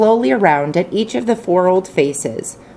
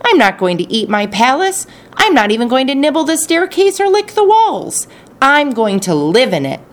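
high indignant outburst; huffy royal protest; indignant prince; 9 semitones higher than usual. Acted. Crazy prince refusing, indignant.

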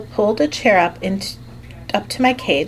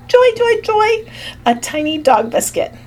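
delighted squeal; 13 semitones higher than usual. Acted. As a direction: squeal with tiny-creature glee. Hamster thrilled by a biscuit.

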